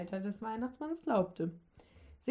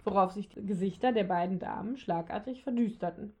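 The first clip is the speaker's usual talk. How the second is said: usual speaking voice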